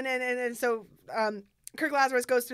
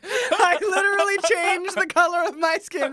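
loud, higher, slowed-down mock outrage; mock indignation; 5 semitones higher, 7 dB louder, slower than usual. Fan voicing the actor who changed skin color, mock indignation. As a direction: protest loudly and slowly through laughter.

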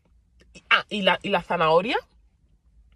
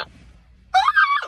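shrill shriek, far higher and louder; startled scream; 6 dB louder than usual. Real, not acted. Vlogger startled mid-story, screaming at viewers. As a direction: jump out of your skin and yelp.

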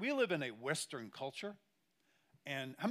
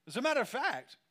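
louder, higher, punched; emphatic loud; 8 semitones higher, 5 dB louder than usual. Real, not acted. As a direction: hit it like a revelation. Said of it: "repeated phrase".

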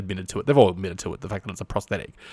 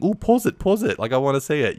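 high, louder mock-granny squeal of interest; excited granny impression; 6 semitones higher, 5 dB louder than usual. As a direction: imitate an eager granny, pitched way up. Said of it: "You've got grannies saying".

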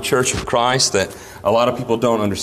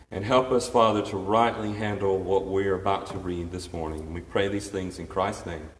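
hushed, much softer prayer voice; 5 semitones lower, 8 dB quieter than usual. Acted. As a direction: pray quietly and reverently for the whole church. Leads the congregation in prayer.

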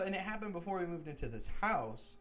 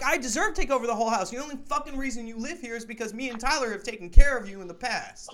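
louder, higher, rattled off fast; fast angry retold rant; 7 semitones higher, 9 dB louder than usual. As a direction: hammer him with reasons, rushing and loud. Retold shouting down the roommate.

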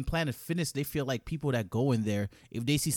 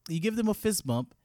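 ordinary delivery

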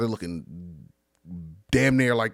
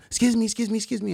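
high, rapid-fire excuse-me's; rude demanding customer; 11 semitones higher, much faster than usual. Retold or imitated. Rude diner pestering the waiters, high and insistent. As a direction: pester the waiters in a raised pitch. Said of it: "the lady was rude".

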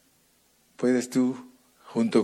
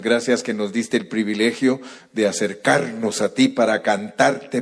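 louder, ardent preaching prayer; fervent prayer; 5 dB louder than usual. Acted. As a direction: pray aloud over the congregation with rising fervor. Pastor leads closing prayer.

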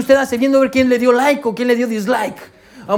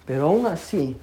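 hushed; 6 semitones lower, 8 dB quieter than usual. Acted. Preacher repeats line, hushed.

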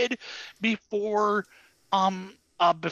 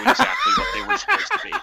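laughing; 5 dB louder, much faster than usual. Real, not acted. Laughing at subtitles.

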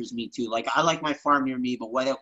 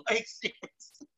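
laughing; 6 semitones higher, 8 dB quieter, slower than usual. Real, not acted. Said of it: "laughing aside".

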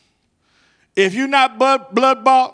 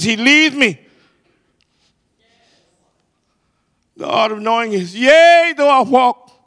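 loud, high, driving preacher cadence; fervent pulpit crescendo; full-force preaching; 5 semitones higher, 7 dB louder than usual. Acted. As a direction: preach it out, building with every line.

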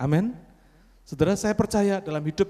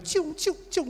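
high sharp whistling ciung, ciung; imitated bullet whizz; 13 semitones higher, slower than usual. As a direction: mimic bullets zipping past, high and quick. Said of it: "Saya cuma dengar".

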